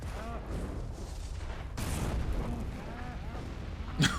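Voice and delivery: silent wheezing laugh. Real, not acted. Breathless laughter.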